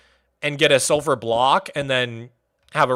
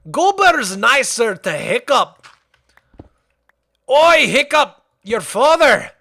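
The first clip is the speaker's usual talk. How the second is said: jolly Viking blacksmith, much higher and louder; Gobber impression; 7 semitones higher, 3 dB louder, slower than usual. Gobber calling to Hiccup, jolly loud Viking voice. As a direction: call out cheerily like a gruff friendly mentor. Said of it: "sounds like Gobber from How to Train Your Dragon".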